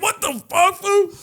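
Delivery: high outraged shout; hammed-up high indignant yell; mock outrage. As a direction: yelp it in high disbelief. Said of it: "I was like".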